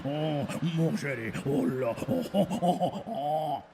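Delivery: crooning mock French lover voice; hammed-up French romantic crooning; Pepe Le Pew French charm. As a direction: coo it like a cartoon skunk seducer. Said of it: "he disguises it with the".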